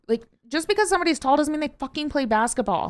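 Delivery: ordinary talk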